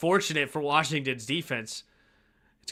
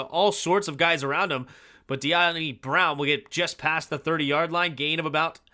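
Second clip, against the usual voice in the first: ordinary talk, nothing marked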